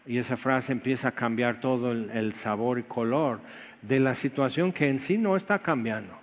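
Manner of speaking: ordinary talk, nothing marked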